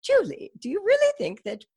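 hammed-up British accent, pitched well up. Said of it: "I'm about to butcher her accent".

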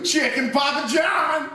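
shouted catchphrase. Real, not acted. Catchphrase shouted after the joke.

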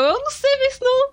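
flustered panic. Retold or imitated. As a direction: blurt it out flustered and helpless.

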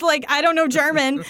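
normal talking voice

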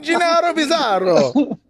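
high, bouncy Italian caricature; Italian character voice. Pretend. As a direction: ham up a cartoon Italian. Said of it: "a musical name".

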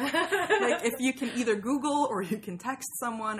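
amused exasperation, laughing; laughing through it. Retold or imitated. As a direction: laugh as you pile up the problem. Laughing mock dilemma.

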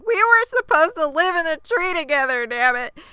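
high-pitched indignant outburst; exasperated retold rant; mock pissed off. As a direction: whine it out, furious and put-on. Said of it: "then he is super pissed".

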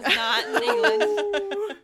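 high, slow words through laughter; laughing. Real, not acted. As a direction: laugh it out, barely holding together. Joking after a botched intro.

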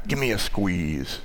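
put-on soft, slow Chicago accent, grandpa teasing. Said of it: "with that Chicago accent".

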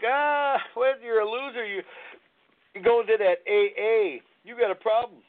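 lightly hammed-up sneering put-down; mocking dismissal. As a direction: imitate scornful friends brushing someone off. Mocks so-called friends' dismissive reply.

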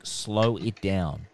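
slow, low and deliberate; slowed right down. Shown as a demonstration. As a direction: draw the words out slowly, dropping in pitch. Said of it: "You're not a machine gun".

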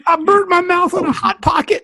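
high-pitched fake crying; mock whimpering. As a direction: whine it like a hammy crier. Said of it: "he's a crier".

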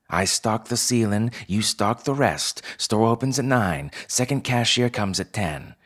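bossy fox co-manager, pitched high; Pell. Acted. Fox co-manager dividing the work with newcomer, bossy.

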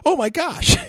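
mother hears her son is on the lake; alarmed parent. Retold or imitated. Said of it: "They're like".

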